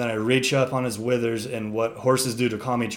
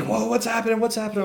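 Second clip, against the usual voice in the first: high startled whoa, much higher pitch; alarmed onlookers; 11 semitones higher, much faster than usual. Retold or imitated. Friends watching the horse bolt, high startled cry. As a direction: sound baffled and startled. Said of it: "everyone's like".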